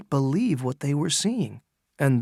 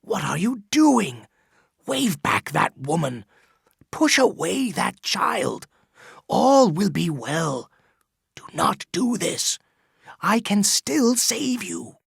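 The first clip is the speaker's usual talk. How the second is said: a lightly voiced old man, pitched higher, pressing and urgent; pleading Chillingworth; 5 semitones higher than usual. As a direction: beg the priest to stop, voice climbing. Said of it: "he whispered".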